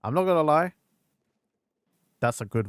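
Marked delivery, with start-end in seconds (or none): 0.0-0.7 excited admission. Real, not acted. Reacting excitedly to voice actor.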